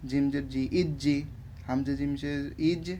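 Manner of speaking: normal talking voice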